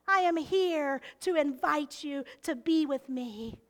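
ordinary delivery

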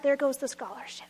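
soft breathy whisper of loss; whispered aside. Acted. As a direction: let the line fall to a whisper. Storyteller drops to whisper.

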